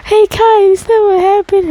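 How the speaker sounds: a high call across the room. Retold or imitated. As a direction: call out to get his attention. Calling out to someone.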